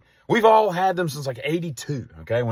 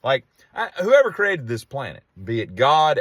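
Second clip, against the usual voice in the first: ordinary delivery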